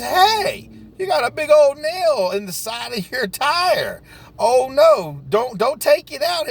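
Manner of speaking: high, urgent warning from a neighbor; startled neighbor pointing out a nail; alarmed warning. Retold or imitated. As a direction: call out in alarm about the tire. Neighbor warns of nail in tire.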